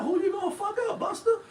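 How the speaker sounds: very high, incredulous mock question; mocking challenge. Mocking Busta's threat to fight, high-pitched laughing taunt. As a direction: squeal the challenge with a laugh at the end.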